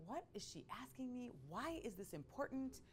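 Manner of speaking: a hint of a kid's anxious wondering; bewildered nephew. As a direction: plead inwardly like a baffled little boy. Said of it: "He was looking at me like".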